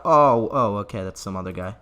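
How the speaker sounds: ordinary talk, nothing marked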